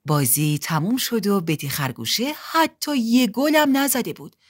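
usual speaking voice

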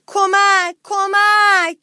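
high, loud, stretched yell; screamed cry for help. A narrator's reading. Mouse hiding under the table, yelling for help. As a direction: shout desperately for rescue. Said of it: "فقط داد زد".

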